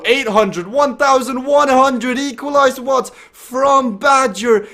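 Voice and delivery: excited commentator building to a sprint finish; live race play-by-play. Real, not acted. Commentator calls Badger's sprint for the line, excited play-by-play.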